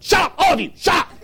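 high, loud mock scolding shout; imitated yell. Mother silencing the crying children, imitated yell. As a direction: shout down a roomful like an angry mother. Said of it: "she turned and she yelled".

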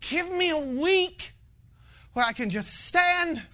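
desperate tired plea; pleading prayer. Retold or imitated. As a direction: plead with God for breathing room. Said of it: "this was the terminology that I used".

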